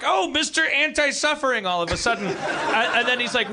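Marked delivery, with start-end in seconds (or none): 0.0-1.7 sarcastic wife. Retold or imitated. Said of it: "And she's like".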